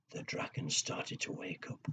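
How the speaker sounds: hushed building tension; suspense whisper. Acted. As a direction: breathe it out quietly. Suspense moment.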